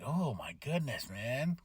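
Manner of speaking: low, hushed disbelief; acted stunned reaction. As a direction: say it softly, as if taken aback. Acts own stunned reaction.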